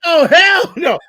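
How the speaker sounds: loud, high outraged shout; hell no shout. Real, not acted. Rejecting the idea that New Yorkers have accents, shouting. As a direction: yell it out in mock outrage. Outraged denial amid banter.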